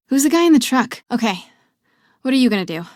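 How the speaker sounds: loud excited chatter; excited call. Acted. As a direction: pump up the energy. Calling out excitedly.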